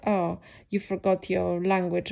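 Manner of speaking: ordinary delivery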